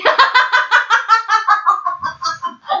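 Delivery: loud, high, rolling laugh; real high-pitched laughing fit; burst of laughter. Real, not acted. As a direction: crack up in a long, helpless run.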